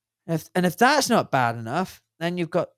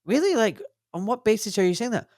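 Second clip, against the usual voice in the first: incredulous; 8 semitones higher than usual. Retold or imitated. Challenging the doctors about vaccines, mock incredulous. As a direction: pitch jumps up in disbelief. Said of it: "you ask the doctors, like".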